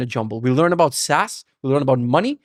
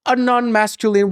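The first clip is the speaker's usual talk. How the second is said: mocking quote; 8 semitones higher, 3 dB louder than usual. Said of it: "people are gonna be like".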